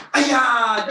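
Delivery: loud, high martial arts kiai; sharp karate-class battle cry; loud yell. Shown as a demonstration. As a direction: yell it out high, falling away at the end. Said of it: "Loud yell on the second punch".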